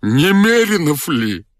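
high, drawn-out whine; slow, high-pitched weepy question; tearful voice. A narrator's reading. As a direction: ask pleadingly, as if crying. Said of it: "спросил он плачущим голосом".

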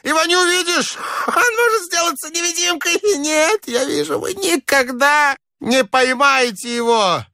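put-on shrill, manic old man; high-pitched crazed outburst; piercing and shouty. A narrator's reading. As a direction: crow it in a very high screech. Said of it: "засмеялся как-то пронзительно и крикливо".

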